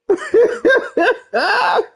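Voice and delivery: cracking up, pitch shooting way up; real squealing laughter; high squealing laugh. Real, not acted. Laughing at the game.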